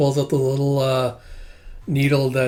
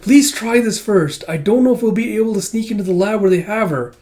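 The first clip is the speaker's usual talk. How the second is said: Kippa's voice; 8 semitones higher, 5 dB louder than usual. Retold or imitated. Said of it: "It's like".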